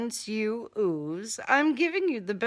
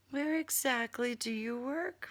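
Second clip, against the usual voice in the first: ordinary delivery, nothing marked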